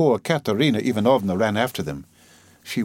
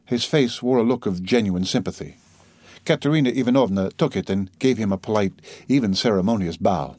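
plain reading voice

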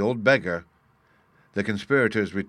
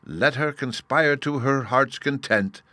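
ordinary delivery, nothing marked

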